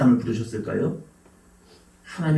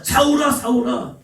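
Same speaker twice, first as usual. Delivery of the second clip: calling out; 9 semitones higher, slower than usual. Retold or imitated. God calling Saul.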